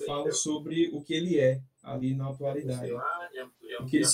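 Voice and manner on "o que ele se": heavy echo on the call line; cathedral echo. Real, not acted. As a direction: voice echoing in a big room. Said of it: "tá, tá ecoando aqui".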